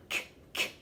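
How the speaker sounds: "k" as a bare click, twice; letter sound demo. Shown as a demonstration. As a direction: release "k" alone, pausing after each. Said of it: "compass begins with a".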